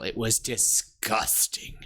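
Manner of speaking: slow, voiceless, drawn-out disgust; acted disgust. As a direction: stretch it out in a hammed-up whisper. Mentor's scornful reveal of romance.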